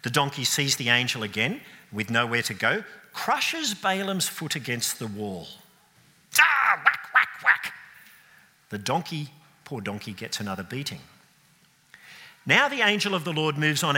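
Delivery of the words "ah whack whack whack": loud, high mock whacks; whacking sound. Preacher acting Balaam beating the donkey, loud whacks. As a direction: smack out each whack like a stick blow.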